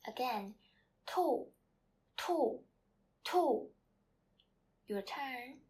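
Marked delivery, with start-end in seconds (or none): 1.1-3.7 "Tu" loud and high, falling sharply; fourth tone. Shown as a demonstration. Teacher drilling students to repeat, fourth tone on "Tu". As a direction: say each "Tu" separately with a steep drop. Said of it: "Chinese word with the fourth tone is tu".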